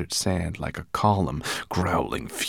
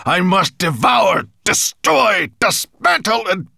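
high, roaring dragon rant; hungry monster at full bellow; 9 dB louder than usual. A narrator's reading. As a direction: roar it word by word, building higher. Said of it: "he bellowed".

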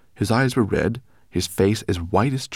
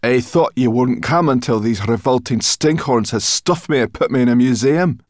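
higher, loud, indignant brownie; angry brownie girl; 7 semitones higher, 9 dB louder than usual. Acted. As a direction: spit out her disgust.

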